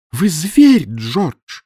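high, loud furious shout; крик. A narrator's reading. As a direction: yell it at her husband in rage. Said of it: "кричала она". (гневный крик)

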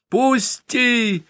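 put-on high squeal of a scared boy; a child's sudden high shriek. A narrator's reading. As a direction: shriek to be let go. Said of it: "неожиданно заверещал Яшка".

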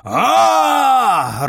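long, very high gleeful roar; loud, stretched battle yell; joyful roar. A narrator's reading. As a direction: roar with wild delight. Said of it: "радостно взревел мой противник".

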